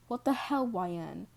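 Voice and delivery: loud furious shout, pitch jumping up; angry yell. A narrator's reading. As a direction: yell at her for vanishing. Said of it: "yelling at you".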